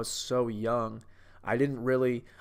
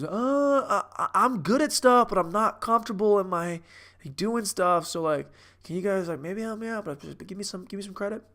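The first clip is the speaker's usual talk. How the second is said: Matt Damon; 6 semitones higher than usual. Acted. Unsure guy asking the group for some credit, high hesitant impression. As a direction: play the unsure guy, pitched up and halting. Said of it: "kinda like the guy who's".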